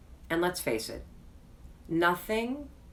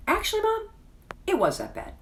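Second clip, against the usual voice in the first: put-on blunt teenage son. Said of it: "And he says".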